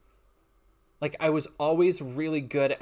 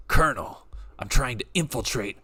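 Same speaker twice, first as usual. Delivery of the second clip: loud, low soldier voice; Snake voice; 3 semitones lower, 4 dB louder than usual. Acted. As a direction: play a stealth soldier calling base. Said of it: "can I do a good Snake voice now".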